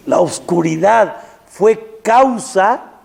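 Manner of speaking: loud, slow, high proclamation; preaching climax. Acted. Preacher proclaiming to the congregation, loud and slow. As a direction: drive the point home.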